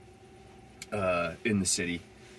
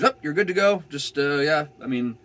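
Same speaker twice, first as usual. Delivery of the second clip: much higher, louder, upbeat officer; cheery cop; 7 semitones higher, 6 dB louder than usual. Retold or imitated. Cop handing back the license, high and cheery. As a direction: play the relaxed cop waving him off.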